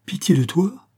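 high, sharply rising question; indignant disbelief, pitch leaping up; incredulous echo. A narrator's reading. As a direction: throw it back as a disbelieving question. Alberte echoes sister's words, incredulous.

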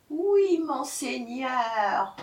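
high, slow, quavering obedience; meek jackal. A narrator's reading. Jackal obeying the furious tiger, meek. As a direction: draw it out submissively.

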